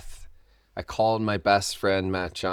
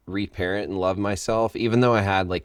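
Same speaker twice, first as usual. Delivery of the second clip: usual speaking voice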